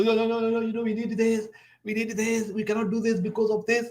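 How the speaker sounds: Kenyan expert impression. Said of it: "Kenyan expert talking. That's how they talk".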